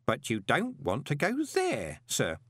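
slow, patient warning; patronisingly slow, drawn-out words; as to a child. Acted. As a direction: spell it out slowly for a small child. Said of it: "as if talking to a child".

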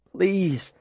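high, desperate plea; pleading. A narrator's reading. Boy calling through an empty town, pleading. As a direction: beg under the shouting. Desperate begging between shouts for help.

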